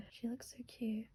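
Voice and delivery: soft unvoiced whisper of delight; whispered gushing. Real, not acted. Vlogger whispering to camera over [name] pics, hushed. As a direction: whisper it, starstruck.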